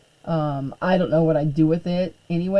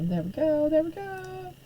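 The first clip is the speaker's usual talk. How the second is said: much higher, lilting sing-song; playful sing-song; 8 semitones higher, 8 dB quieter than usual. Pretend. Teacher going live on Facebook, playful high sing-song. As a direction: toss it off in a high playful lilt.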